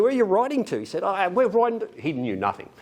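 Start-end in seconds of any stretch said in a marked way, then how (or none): 0.0-0.8 higher, questioning official voice; lightly voiced border official quizzing a teen; customs officer voice. 1.0-1.9 put-on higher, hesitant young voice; a clueless kid trailing off; unsure teen son.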